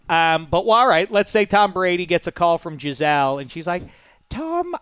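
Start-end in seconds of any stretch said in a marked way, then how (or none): 4.3-4.8 high, lightly voiced Brazilian wife calling; a quick stab at the wife's voice; wife calling her husband, lightly imitated. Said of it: "I can't do a Brazilian accent".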